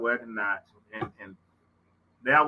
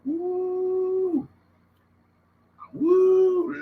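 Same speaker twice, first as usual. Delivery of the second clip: relieved whoop; 3 dB louder than usual. Real, not acted. Relief release exclamation.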